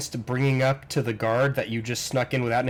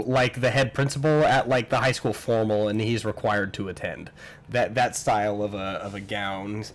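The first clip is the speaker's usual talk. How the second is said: ordinary talk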